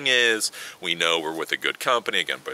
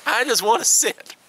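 put-on little old lady; 14 semitones higher than usual. Old Indian lady to the stewardesses, high mock granny voice. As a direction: play a sweet old lady who just wants a seat. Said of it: "She's just like".

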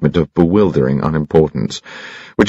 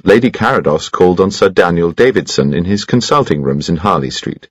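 plain reading voice

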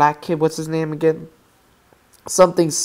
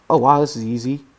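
ordinary talk, nothing marked

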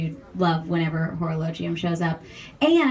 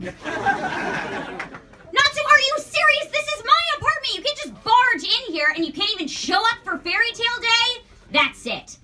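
high, loud exasperated yell; yelling at Natsu; 11 semitones higher, 4 dB louder than usual. Acted. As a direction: scold him at the top of your voice. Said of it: "usually everybody wants to hear me yell at Natsu".

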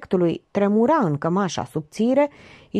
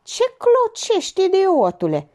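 high, piercing scolding; shrill, irate woman; shrill yell; 13 semitones higher, 4 dB louder than usual. A narrator's reading. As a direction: screech it sharply, stretching the opening. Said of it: "țipa ascuțit Georgeta".

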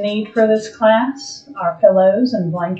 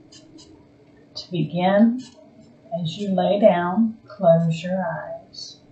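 slow, calm meditation voice with long pauses; guided meditation; 5 dB quieter than usual. Acted. Yoga teacher guiding a resting class into Yoga Nidra, calm guided meditation. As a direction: ease them gently toward stillness, phrase by phrase.

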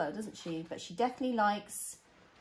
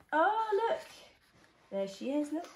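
excited oh; 6 semitones higher than usual. Real, not acted. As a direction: call out in delight. Spotting picture.

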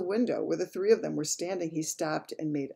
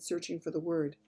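usual speaking voice

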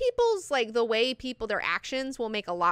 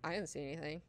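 small, soft, lower denial; feigned ignorance; 4 semitones lower, 12 dB quieter, faster than usual. Retold or imitated. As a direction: play dumb, quietly brushing it off. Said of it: "Irulan just acts like".

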